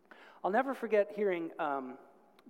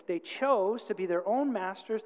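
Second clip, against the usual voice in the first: usual speaking voice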